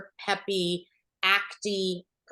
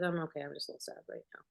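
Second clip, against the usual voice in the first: much softer, sincere hurt voice; real quiet sadness; 4 semitones lower, 12 dB quieter, much faster than usual. Retold or imitated. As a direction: let the real grief slip out quietly. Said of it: "What just happened?".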